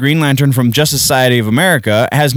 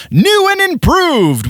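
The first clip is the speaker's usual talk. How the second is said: "improved" pitched up like a commercial. Retold or imitated. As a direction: sell it like a TV pitchman. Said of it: "what they would bill on the televisions as".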